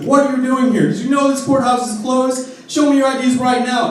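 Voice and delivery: high, loud furious bark; irate cop yelling. Retold or imitated. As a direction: scream it like an enraged patrolman. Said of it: "He's screaming at us".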